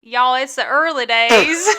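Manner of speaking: jokey Southern twang; Southern accent. Shown as a demonstration. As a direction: try on a Southern accent, half laughing. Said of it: "a southern accent".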